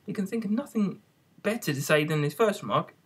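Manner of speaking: usual speaking voice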